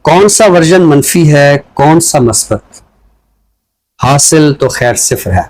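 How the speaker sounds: measured, deliberate reading of a poem; poem recitation. Acted. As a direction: give each line weight and leave pauses between phrases. Poet recites his poem. (ٹھہر ٹھہر کر نظم کی سنجیدہ قرأت)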